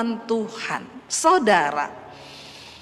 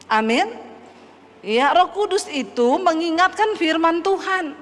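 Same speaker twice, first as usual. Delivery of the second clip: ordinary talk, nothing marked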